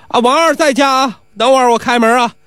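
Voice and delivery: high-pitched answering call; calling back. Acted. Doorman telling the guest to wait, calling back. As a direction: pitch it up to reach the gate.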